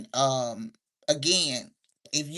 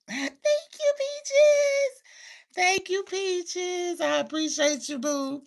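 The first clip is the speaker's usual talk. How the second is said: very high, drawn-out squeal; sweet sing-song falsetto; gushing thanks; 12 semitones higher, slower than usual. Real, not acted. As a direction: coo it high and slow to a fan. Thanking a viewer for a gift.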